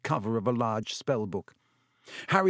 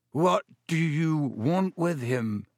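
quavering voice; 4 semitones higher, slower than usual. A narrator's reading. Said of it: "said Ernie in a quavering voice".

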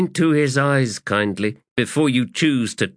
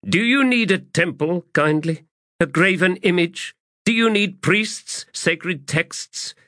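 much higher, biting rhetorical questions; challenging; 12 semitones higher than usual. Acted. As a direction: scorn them with each question. Adjunct confronts the officers.

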